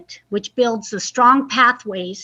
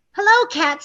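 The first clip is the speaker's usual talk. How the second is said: higher friendly hello; chirpy duck voice; 6 semitones higher than usual. Acted. Duck greets the cat, higher chirpy voice. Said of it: "said Duck".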